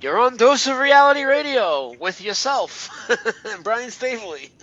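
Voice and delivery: slow, high, loud announcer voice sweeping into the show name; radio host intro. Acted.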